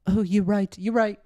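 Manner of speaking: a high, timid giggly agreement; meek co-host. Retold or imitated. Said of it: "Pika just, like, kinda laughs".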